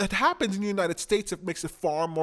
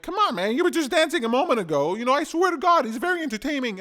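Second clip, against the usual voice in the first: exasperated pleading; 8 semitones higher, 6 dB louder, faster than usual. Retold or imitated. Pleading with the frog.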